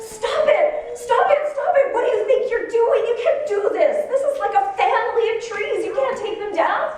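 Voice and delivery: high, loud pleading with laughter breaking through; retold screaming. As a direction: replay the outburst, half laughing. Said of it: "I started crying and screaming".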